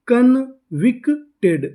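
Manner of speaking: drawn out, syllable by syllable convicted; slow blending. Shown as a demonstration. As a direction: stretch convicted slowly so each sound is heard. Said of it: "पूरी meaning क्या बनी?".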